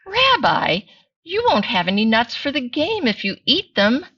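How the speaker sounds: high, lively grandmotherly voice; Bubby Braina voice. Acted. Grandmother scolding the bear-rabbi, in a high granny voice.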